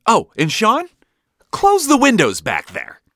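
loud, high shouted command; barked order. A narrator's reading. Dad ordering Sean to close windows, barking. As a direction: call it sharply to the back seat. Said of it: "Dad then added".

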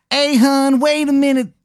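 high, exaggerated Maryland accent; worst Maryland accent. Stranger calls after leaving player in bad Maryland accent. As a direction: call out in an awful Maryland accent. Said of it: "the worst Maryland accent".

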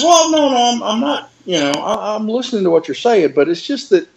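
doctor hastily covering himself, pitched high; flustered doctor. Retold or imitated. Doctor backpedalling, defending his doubt.

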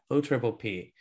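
usual speaking voice

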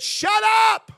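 sudden furious yell, high and loud; angry shout. Retold or imitated. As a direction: bark it at the preacher to silence him. Angry father yells at preacher.

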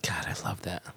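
breathy awed whisper. Real, not acted. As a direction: hush it out in awe. Whispered admiration of scene.

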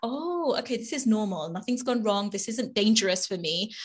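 soft, relieved realization; calm self-reassurance. Retold or imitated. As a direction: settle yourself down quietly. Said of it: "helps my nervous system to get on board".

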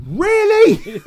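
high, loud disbelieving cry; mock incredulity. Acting the investigator hearing the confession, mock incredulous. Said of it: "You're like".

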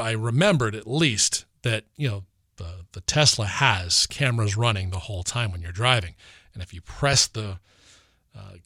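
usual speaking voice

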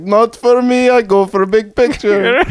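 lightly put-on Indian accent; imitating Bikram. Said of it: "and he's like".

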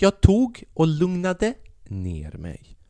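exaggeratedly slow show of composure; mock calming down. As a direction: stretch every word as if soothing yourself. Acting out calming himself down.

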